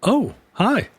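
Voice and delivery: high, startled little hello; awkward high hello. Retold or imitated. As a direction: greet back, caught off guard. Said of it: "And I was like".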